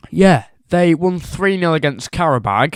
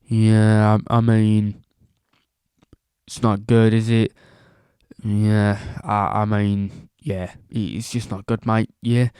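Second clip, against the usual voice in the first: imitating the Spurs manager in a bad Australian accent; 6 semitones lower than usual. Press conference impression, a bad Australian accent, low and deflated. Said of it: "That was probably the worst Australian accent ever".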